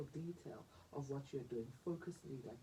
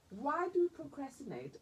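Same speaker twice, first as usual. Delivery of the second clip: emphatic question; 11 semitones higher, 6 dB louder than usual. Real, not acted. Host raises the big question.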